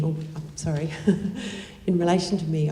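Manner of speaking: usual speaking voice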